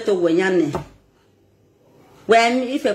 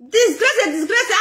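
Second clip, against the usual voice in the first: mocking; 8 semitones higher than usual. Mocking the insult.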